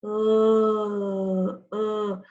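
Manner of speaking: "Uh" held long, the middle vowel of push alone; isolated vowel sound. Shown as a demonstration. Teacher listening for the missed middle sound, vowel drawn out. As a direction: stretch "Uh" out, then repeat it shorter. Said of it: "a sound that we missed in the middle".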